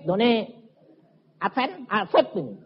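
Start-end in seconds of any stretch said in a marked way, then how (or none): none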